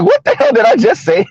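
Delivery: high-pitched, amused bafflement; mock disbelief. Host retelling his reaction to his own mistake, mock disbelief. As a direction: question yourself in high, playful bewilderment.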